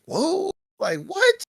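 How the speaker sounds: very high-pitched disbelief; astonished. Retold or imitated. Speaker recalling the moment it happened, high-pitched astonishment. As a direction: squeak out your shock, voice leaping up. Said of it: "and I was like".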